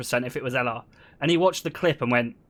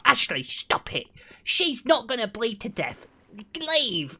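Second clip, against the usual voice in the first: retold scolding mum; 6 semitones higher, slower than usual. Mum scolding taunting son.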